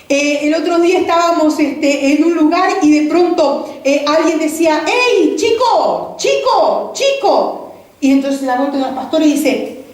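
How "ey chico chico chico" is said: higher, slow drawn-out call; imitating a stranger calling out. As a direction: call to someone across the street. Someone calling out in street.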